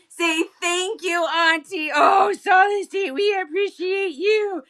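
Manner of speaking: loud, high, excited thank-you; voicing the pet. For the pet to Auntie Solid State, loud and high. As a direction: gush thanks on the pet's behalf.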